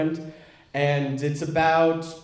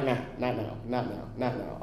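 muttered brush-off; 5 semitones lower, 11 dB quieter than usual. Retold or imitated. Dismissing calls from other woman.

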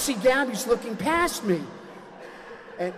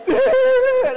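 piercing high scream, much louder; child's scream; 11 dB louder than usual. Retold or imitated. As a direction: shriek like the girl in the tub. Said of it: "Gabby's like".

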